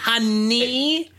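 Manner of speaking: high mocking honey like the biker lady; mocking Mother Wheels. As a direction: squeal her honey back high. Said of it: "how she kept going".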